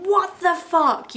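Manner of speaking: slow, loud and high outburst; explosive anger. Retold or imitated. Acting out Erica's explosive anger at Rand, loud. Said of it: "Just this explosive anger of".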